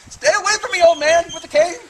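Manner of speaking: high, loud scared yell; scared and angry. Pretend. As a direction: shout him off in mock fright. Playful mock attack by cane.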